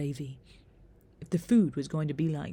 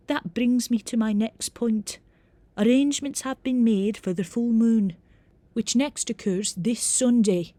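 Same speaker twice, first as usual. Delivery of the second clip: businesslike Scottish professor, higher and louder; reedy Scottish accent; 5 semitones higher, 7 dB louder than usual. A narrator's reading. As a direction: give formal instructions in reedy Scottish tones. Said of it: "in a reedy Scottish accent".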